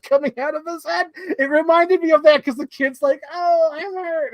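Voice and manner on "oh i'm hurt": high, slow whimpered complaint; mock hurt kid. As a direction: play a hurt child. Kid hurt on baseball ground.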